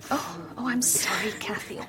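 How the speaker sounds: soft comforting whisper. Acted. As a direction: whisper gently to a crying girl. Comforting crying Kathy.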